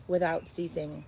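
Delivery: plain reading voice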